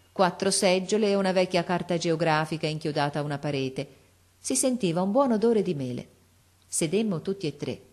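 ordinary delivery, nothing marked

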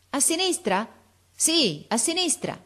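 high, falling calls; calling across. A narrator's reading. Officer and boy in tree, calling. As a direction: pitch it up to carry.

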